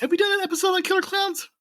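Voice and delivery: very high, quick question. Real, not acted. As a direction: voice shoots way up on the question. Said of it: "my voice gets high when I ask questions".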